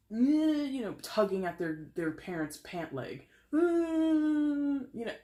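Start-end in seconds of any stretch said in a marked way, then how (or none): 0.1-0.1 high imitated child's whine; whining kid noise. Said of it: "visual before of a kid". 3.5-3.6 long, high, loud whine; imitated kid's whine. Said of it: "Who are you whining to?".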